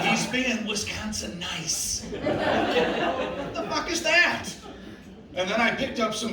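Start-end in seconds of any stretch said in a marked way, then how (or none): 0.1-2.0 Wisconsin nice. Retold or imitated. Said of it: "He goes". 2.1-4.5 high, slow, drawn-out disbelief; baffled. Real, not acted. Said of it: "bewildered reaction".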